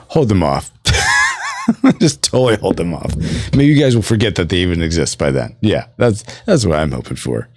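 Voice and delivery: normal talking voice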